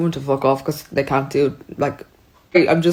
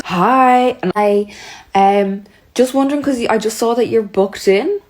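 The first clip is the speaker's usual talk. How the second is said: higher, lightly voiced phone greeting; tentative caller, pitched up; hint of a salon caller; 5 semitones higher than usual. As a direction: put on a higher voice for an awkward call. Salon caller's phone call acted out.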